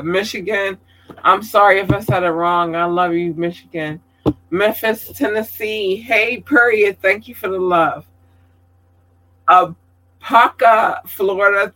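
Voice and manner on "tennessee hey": normal talking voice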